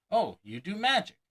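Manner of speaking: high, loud delighted "Oh, you do magic"; exclaiming layperson. Retold or imitated. Layperson learning of the talent, exclaiming in delight. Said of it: "they'll likely exclaim".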